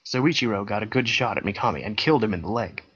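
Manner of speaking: ordinary delivery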